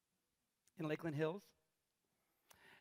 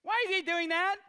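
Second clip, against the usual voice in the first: put-on little girl; 11 semitones higher, 9 dB louder than usual.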